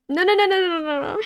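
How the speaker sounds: high, loud flustered no's; high embarrassed protest. Real, not acted. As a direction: squeal out the denial. Embarrassed protest after laugh.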